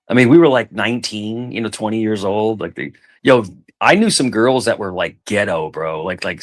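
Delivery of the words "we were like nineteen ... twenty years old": ordinary talk, nothing marked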